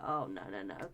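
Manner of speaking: deep, groaning no no no; cringing low groan of refusal; mock cringe. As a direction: drop low like a disgusted viewer. Mock reaction to corny films.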